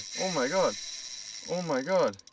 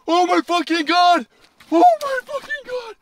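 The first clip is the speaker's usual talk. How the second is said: excited exclamations; 10 semitones higher, 4 dB louder than usual. Real, not acted. Still reacting to huge fish.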